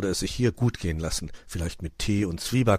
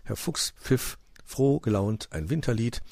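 usual speaking voice

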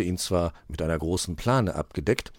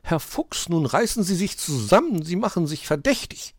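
high, urgent scolding, starting hushed; hissed reprimand; 11 semitones higher, 4 dB louder than usual. A narrator's reading. Magpie tells fox to pull himself together, hissing. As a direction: hiss a warning at an accomplice. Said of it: "zischte die Elster".

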